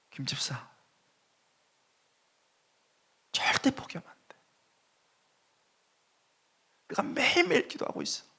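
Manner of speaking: high whisper choked with tears; a leader urging a broken member not to give up, in a high tearful whisper; tearful encouragement. Retold or imitated. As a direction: whisper the comfort through sobs. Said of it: "엉엉 운 거예요".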